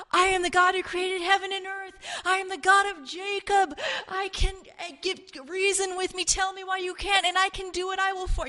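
high, impassioned declaration; God the Father. Retold or imitated. The Father cries out over his people, impassioned. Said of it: "you've got the Father going".

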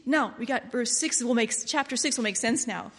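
normal talking voice

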